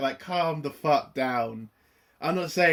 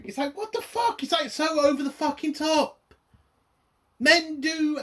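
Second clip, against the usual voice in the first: much higher, squeaky exasperation; exasperated squeal; 12 semitones higher than usual. Real, not acted. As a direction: shriek it in disbelief. Ranting about the episode.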